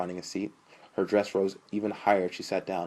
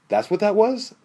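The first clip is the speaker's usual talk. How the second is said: high, loud, rising amazement; delighted disbelief; excited question; 10 dB louder than usual. A narrator's reading. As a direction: ask it thrilled, pitch leaping up. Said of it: "Sean asked excitedly".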